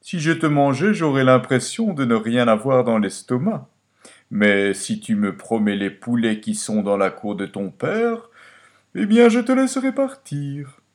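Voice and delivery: lightly voiced bargaining fox. A narrator's reading. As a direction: strike a bargain as a storybook fox. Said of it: "répondit le renard".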